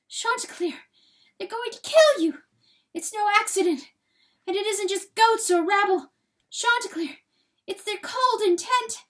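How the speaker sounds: high, loud, frantic begging; frantic pleading. A narrator's reading. Hen begging rooster to escape, high frantic plea. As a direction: plead with him to flee, high and desperate. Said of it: "in her frustration".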